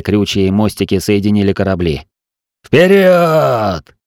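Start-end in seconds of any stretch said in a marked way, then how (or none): 2.6-4.0 high, drawn-out rallying shout; charge cry. A narrator's reading. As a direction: send the boarders charging forward. Leading the boarding charge.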